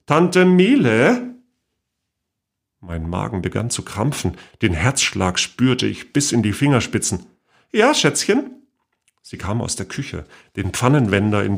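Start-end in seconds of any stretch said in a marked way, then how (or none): 0.1-1.3 high, loud, slow stunned question; the name drawn out in disbelief; shocked realisation. A narrator's reading. Niece hearing the radio report, stunned and high. 7.7-8.6 much higher, sugary aunt voice; sweet old aunt. A narrator's reading. Great-aunt answering her niece from the kitchen, sweetly higher.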